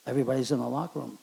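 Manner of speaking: ordinary talk, nothing marked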